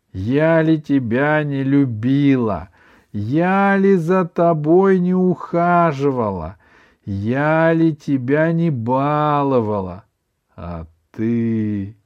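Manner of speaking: slow, tearful lament; bitter weeping. A narrator's reading. Grandmother reproaching the grandson she spoiled, bitter weeping. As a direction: wail each reproach, drawn out. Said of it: "снова горько расплакалась".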